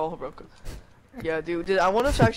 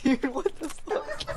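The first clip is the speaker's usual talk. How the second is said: cracking up; laughing; 7 semitones higher, 5 dB quieter, slower than usual. Real, not acted. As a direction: laugh through the words. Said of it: "laughing like a hyena".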